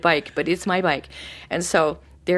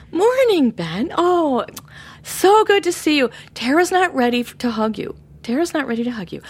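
cheerful greeting; 8 semitones higher, 3 dB louder than usual. Retold or imitated. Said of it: "And I would say".

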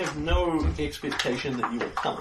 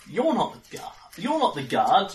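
high, raised voice of startled realisation; alarmed disbelief, pitched up; startled shouting; 6 semitones higher, 5 dB louder than usual. Acted. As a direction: cry out, thrown by who walks in. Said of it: "he shouts out".

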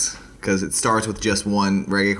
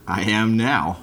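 ordinary talk, nothing marked

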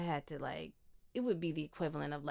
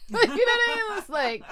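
laughing through the words, much higher and louder; 14 dB louder, faster than usual. Real, not acted. Laughing to her friend about wanting to stop, high and loud. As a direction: let the laugh carry the question.

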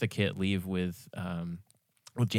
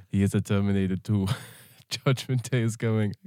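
loud put-on Arnold voice, laughing through; hammed-up Austrian action star; Schwarzenegger voice; 5 dB louder than usual. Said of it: "I'm not gonna do the voice".

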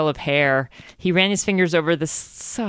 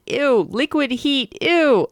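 disgusted; 7 semitones higher, slower than usual. Real, not acted. Disgusted commentary inside reading.

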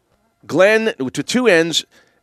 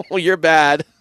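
laughing. Real, not acted. Host scolds caller's joke, laughing.

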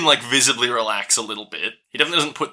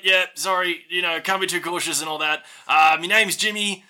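higher, friendly Aussie bloke; Aussie doorkeeper; 4 semitones higher than usual. Acted. Doorkeeper Jimmy welcomes the party, higher and cheery. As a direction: wave them in with a cheery mate.

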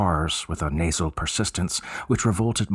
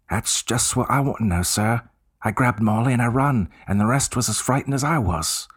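higher Cockney voice of an eager bellboy; 4 semitones higher than usual. Acted. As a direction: play the chatty Cockney servant, keen to help. Cockney bellboy recalls fright.

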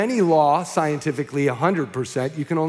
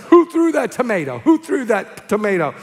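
loud, raised-pitch outraged demand; angry heckled speaker; 5 semitones higher, 4 dB louder than usual. Retold or imitated. Pelted soapbox speaker confronts the crowd, angry. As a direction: demand the culprit, easing slightly on the repeat. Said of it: "he got all angry".

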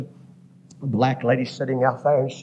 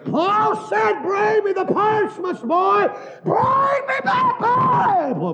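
preaching at full cry; slower than usual. Acted. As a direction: cry out the plea with all your might. Preaching Paul's plea at a shout.

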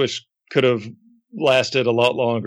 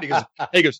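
usual speaking voice

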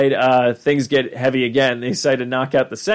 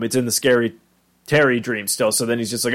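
normal talking voice

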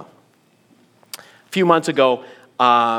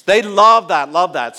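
sarcastic; 5 dB louder than usual. Acted. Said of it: "every mechanic and repairman loves someone hovering".

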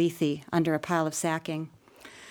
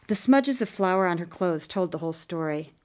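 plain reading voice

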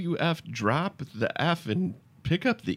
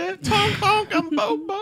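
silly clown; slower than usual. Acted. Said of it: "he's honking on the".

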